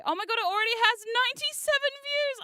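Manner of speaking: high, loud rush of delight; excited. Real, not acted. Streamer sees the views on the new video, excited. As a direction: burst out, thrilled and high-pitched.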